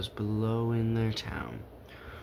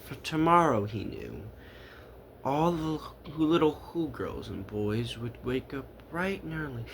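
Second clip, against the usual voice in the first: ordinary delivery, nothing marked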